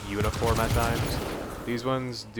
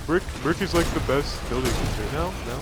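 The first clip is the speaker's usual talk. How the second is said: ordinary delivery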